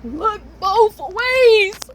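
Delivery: slow, high, loud stretched-out reminder; singsong stretched warning to her past self; drawn-out advice. Acted. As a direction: drag every word out high and loud.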